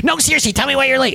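high-pitched mock interrogation; insistent boss. Manager confronts late employee, mock insistent demand.

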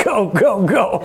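playful "go, go" egging-on; excited urging. Retold or imitated. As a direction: urge it on, half laughing. Retells cheering on the cooling storm.

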